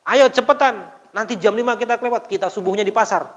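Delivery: high, loud, hurried urging; urging older brother. Retold or imitated. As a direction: hurry your little brother along. Older brother urging sibling at dawn. (kakak mendesak adiknya, nada tinggi dan keras)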